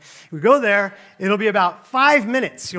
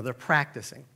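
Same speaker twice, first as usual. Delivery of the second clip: ordinary talk, nothing marked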